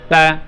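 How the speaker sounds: "তা" with the sound cut short as soon as the mouth opens; cut-off vowel. Shown as a demonstration. Teacher shows pupils how to end the letter, "তা" cut off short. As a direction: say "তা" and stop the voice at once. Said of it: "আওয়াজটাকে কেটে দিতে হবে".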